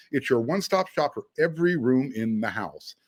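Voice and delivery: ordinary delivery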